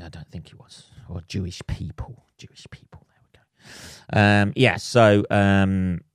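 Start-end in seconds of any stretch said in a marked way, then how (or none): 0.0-4.2 sheepish whisper. Real, not acted.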